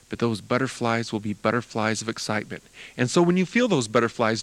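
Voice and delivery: ordinary talk, nothing marked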